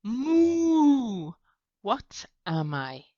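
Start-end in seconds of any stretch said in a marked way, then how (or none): 0.0-1.3 high, loud drawn-out moo; hammed-up cow moo for kids. Shown as a demonstration. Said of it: "and I make the sound".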